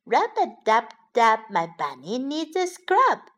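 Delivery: ordinary delivery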